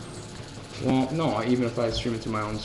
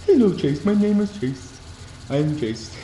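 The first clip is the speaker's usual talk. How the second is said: Chase voice; 5 semitones higher than usual. Pretend. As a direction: speak as the dopey dog, pitched up. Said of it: "I make this voice every time I think of Chase".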